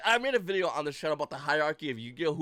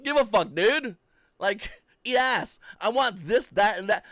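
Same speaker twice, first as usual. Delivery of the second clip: louder, slow, blunt demands; defiant demand; 5 semitones higher, 6 dB louder, slower than usual. Retold or imitated. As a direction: lay into the company with a raised voice. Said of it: "When it should be like".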